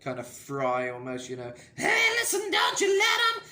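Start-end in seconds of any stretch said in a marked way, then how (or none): 1.7-3.4 high reinforced falsetto with vocal fry; harsh fry falsetto. Shown as a demonstration. Coach showing the harsh reinforced falsetto. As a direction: push harsh grit into the falsetto. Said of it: "a bit of a harsh, harshness to the vocal, kind of fry".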